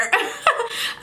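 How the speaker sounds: high, airy giggle; laugh. Real, not acted. Laughs off the quote.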